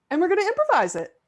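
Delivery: high, very fast chirpy delivery; tossing off a bombshell lightly; cheerful, rapid-fire; breezy announcement. Retold or imitated. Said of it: "And she was like".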